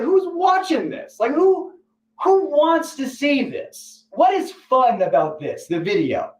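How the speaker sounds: very high, very slow bewildered question; baffled. Retold or imitated. Asking who wants to watch this, high and slow. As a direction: stretch out the disbelief, way up in pitch. His own baffled reaction, acted.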